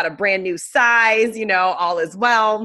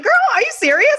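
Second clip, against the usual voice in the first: high-pitched, louder disbelief; mock incredulous; 5 dB louder, faster than usual. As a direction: push back at a friend, scandalized. Retelling her shocked denial to friend.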